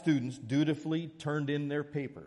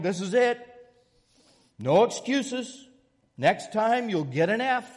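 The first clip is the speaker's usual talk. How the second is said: severe warning; 9 semitones higher, 7 dB louder than usual. Retold or imitated. Professor warning the late students, loud stern voice. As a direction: lay down the law to the class. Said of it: "with a severe warning".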